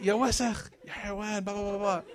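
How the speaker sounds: high-pitched mock tirade; mom going in. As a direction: imitate mom ranting, trailing into blahs. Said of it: "she just goes in".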